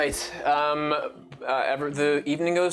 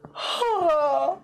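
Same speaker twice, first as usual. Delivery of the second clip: a sudden excited gasp, pitched high; sharp high gasp; 14 semitones higher than usual. Acted. GM gasping before Nova's dream. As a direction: gasp like an idea just hit.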